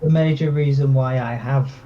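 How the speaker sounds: ordinary talk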